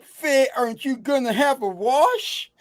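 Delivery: a high, prissy English-style "aren't you gonna have a wash"; mock English accent. Brother back from London speaks to Faye in a mock-posh English accent. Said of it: "you went to England five days".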